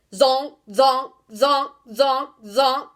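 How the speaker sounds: loud, sharp barks; loud barking zonks; barked. A narrator's reading. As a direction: bark each zonk out loud. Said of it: "he barked".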